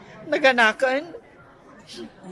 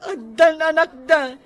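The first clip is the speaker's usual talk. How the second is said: sobbing complaint; crying; faster than usual. Acted. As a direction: break down as you tell it. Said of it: "Saan ka nga agsangi".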